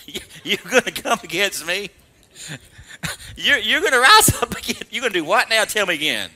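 scoffing, incredulous voice of God; mocking God. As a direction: taunt like God amused at rebels. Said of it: "It's like God said".

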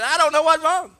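put-on loud, high, exasperated Korean accent; broken Korean-English. Korean master puzzled by her students, broken Korean-English. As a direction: imitate a frustrated Korean master. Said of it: "she talks sort of broken Korean".

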